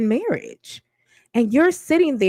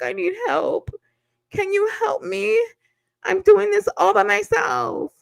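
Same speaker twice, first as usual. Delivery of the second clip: high, whimpering mock plea; mock crying contestant; 10 semitones higher than usual. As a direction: ham up a helpless bride begging for help. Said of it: "crying like".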